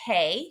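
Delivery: "K" as a lone syllable with a long A; "K" set apart, ending on an open long A; syllable sounded out. Shown as a demonstration. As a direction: isolate "K" so the A is clear. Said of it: "That's why it's pronounced".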